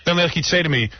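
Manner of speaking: ordinary talk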